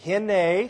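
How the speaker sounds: Hine said as hee-neh; Hebrew word. Shown as a demonstration. Pastor teaching the congregation a Hebrew word. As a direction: say Hine clearly as hee-neh for them to repeat. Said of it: "I want to say the word".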